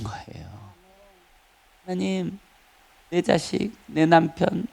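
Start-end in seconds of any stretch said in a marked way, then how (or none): 1.9-4.7 petitioning prayer. Acted. Preacher acting out a worshipper's routine requests, quiet petitioning prayer. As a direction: pray quietly, item by item. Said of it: "이런 것보다는".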